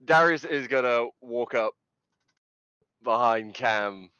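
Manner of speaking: bubbling over with excitement; excited. Real, not acted. Excited player with rising laughter.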